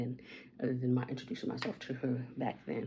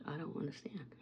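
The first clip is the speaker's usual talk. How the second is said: mock whisper; 10 dB quieter than usual. Pretending not to understand neighbors.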